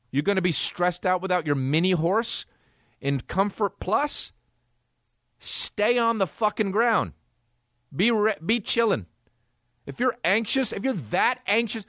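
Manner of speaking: normal talking voice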